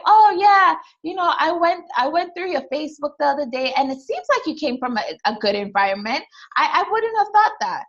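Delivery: high-pitched mock chatty voice; imitated coworker. Coworker remarking on her Facebook page, imitated in a high chatty voice. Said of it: "And this person's like".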